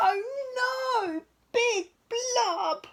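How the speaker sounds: high, loud alarmed cry; frightened cry. Acted. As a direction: shriek as the monster lunges. Monster appears, reader cries out.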